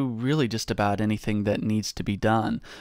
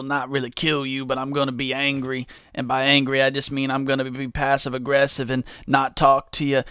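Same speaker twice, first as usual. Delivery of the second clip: usual speaking voice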